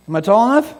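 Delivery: put-on boy on tiptoe asking his dad; hopeful child. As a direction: stretch up and ask hopefully.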